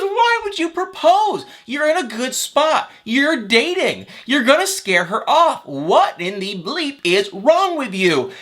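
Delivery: high, slow mock scolding; exasperated mom. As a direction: stretch each word out like an appalled mother. Voicing mom scolding her son.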